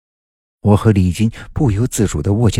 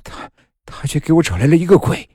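stammered, high-pitched bitter accusation; fierce and agitated, catching on the first word; 8 semitones higher than usual. A narrator's reading. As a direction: spit out the blame, pitch climbing. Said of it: "狠狠地说".

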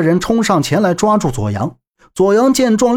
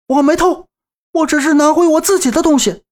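much higher, furious denial; angry shout; 11 semitones higher than usual. Acted. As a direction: shout him down in outrage. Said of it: "左阳直起身怒喝道". (愤怒高声辩解)

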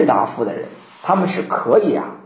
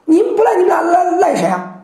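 much higher, accusing voice; indignant Confucius; 10 semitones higher than usual. Acted. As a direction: throw the blame back at them. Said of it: "孔子啪又急了".

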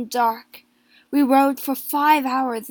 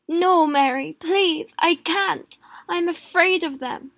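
higher and louder, pleading; panicked begging not to be sent; husband refusing his wife in fear; frightened refusal; 6 semitones higher, 6 dB louder than usual. A narrator's reading.

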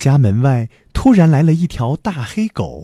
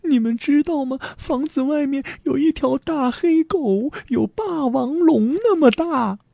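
put-on high, scared girl's voice; alarmed warning; 12 semitones higher, 3 dB quieter than usual. A narrator's reading. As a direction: tell the parents anxiously, pitched high. Frightened daughter warns her parents.

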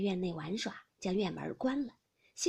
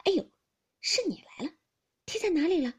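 higher, hurried-sounding concern with an awkward laugh implied; flustered apology; 7 semitones higher, 5 dB louder than usual. A narrator's reading. Baoyu finds he kicked Xiren, flustered and apologetic. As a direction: realize your mistake and cover it. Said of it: "忙笑道".

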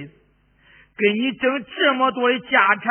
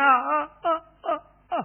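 higher, broken sobbing "啊，啊"; sobbing wails; 6 semitones higher than usual. Acted. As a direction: cry out in sobs for the dead. Servant sobs for masters. (呜咽哭声)

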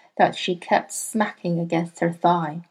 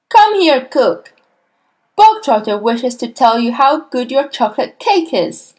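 loud, high opening, then commanding; imperious headmistress announcing to the cook; shouted summons; 5 semitones higher, 7 dB louder than usual. A narrator's reading. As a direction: call it across the hall. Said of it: "the Trunchbull shouted".

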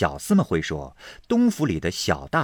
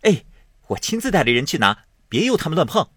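quick, high and a little louder with glee; gleeful rush, pitched up; delighted eager nephew; 9 semitones higher, 5 dB louder, faster than usual. A narrator's reading. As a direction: eagerly promise to fetch it himself. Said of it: "贾蓉喜得眉开眼笑".

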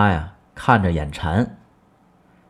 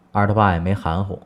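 ordinary talk, nothing marked

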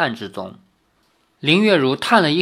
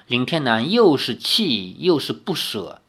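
ordinary delivery, nothing marked

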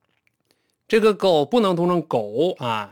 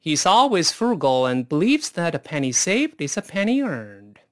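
quicker, flowing read with linked words; faster. Shown as a demonstration. Teacher showing students the sentence read faster. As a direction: run it together at a natural clip. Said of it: "咱们再快一点试试看".

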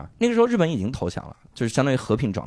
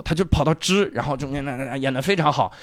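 ordinary talk, nothing marked

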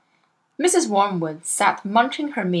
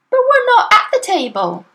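put-on high child voice, shocked and reproachful; a little girl scolding her cheating father; indignant young Matilda; 14 semitones higher, 6 dB louder than usual. A narrator's reading. Matilda protests.

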